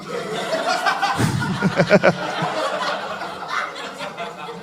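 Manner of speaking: airy high laugh; high breathless laugh. Real, not acted. Comic laughing at his own Special Olympics joke, airy high laugh.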